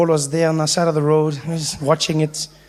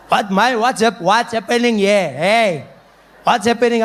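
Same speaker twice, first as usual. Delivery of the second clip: put-on drunk uncle; 2 semitones higher than usual. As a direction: imitate a boisterous drunk uncle. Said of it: "came in, drunk, drunk".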